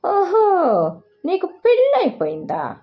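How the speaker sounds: mock surprise. A narrator's reading. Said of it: "పక్కపక్క నవ్వింది".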